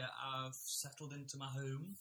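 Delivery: ordinary talk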